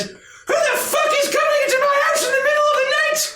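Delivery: put-on high shrieking outraged old hen; furious high hen screaming at night visitors; angry chicken lady. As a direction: screech at the intruders at full blast. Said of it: "she stares at you and says".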